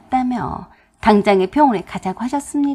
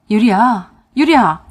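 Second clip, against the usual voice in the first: loud repeated call of the name; urgent calling; 8 dB louder than usual. A narrator's reading. Husband calling unconscious wife, urgent cry. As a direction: call out to wake someone, louder.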